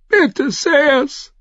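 high, tearful begging; sobbing plea. A narrator's reading. Mother begging the knights for help, sobbing. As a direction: beg them through sobs. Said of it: "schluchzte Lady Thunder".

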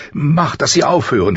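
high, anguished cry to make it stop; screamed plea. A narrator's reading. Mother begging her son to stop the wolves' howling, screamed plea. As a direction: cry it out, pitched well up. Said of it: "schrie sie".

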